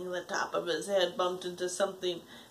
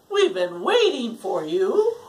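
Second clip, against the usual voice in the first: creature voice; 9 dB louder than usual. Acted. Second creature voice.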